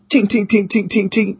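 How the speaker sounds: high, repeated little tinks; imitated tinking. Guest describing the UFO's lights switching on, high tinking imitation.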